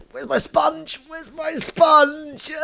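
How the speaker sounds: high, drawn-out mock wail; hammed-up acting. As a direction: wail it theatrically, as if distraught. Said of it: "a little bit of acting there".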